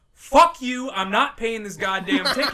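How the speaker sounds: yelling furious defiance; loud defiant yell at the conductor; angry shout. Retold or imitated. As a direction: shout it right in his face. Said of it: "Like that".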